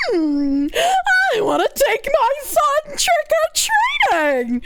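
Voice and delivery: high, slow ditzy mimicry; mock her clueless whine; mocking whiny voice. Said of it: "And she's just like".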